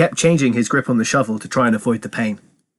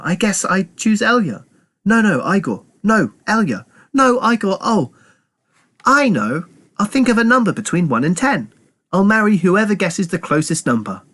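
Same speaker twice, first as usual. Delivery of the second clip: put-on high-pitched, indecisive girl voice; dithering girl Myra; 9 semitones higher than usual. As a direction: dither aloud, then land on an idea, pitched high. Myra voiced in story narration.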